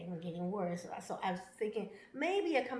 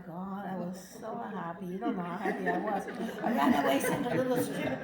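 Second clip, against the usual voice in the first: laughing; 3 semitones lower, 6 dB louder than usual. Real, not acted. Laughing through happy retelling.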